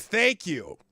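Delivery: normal talking voice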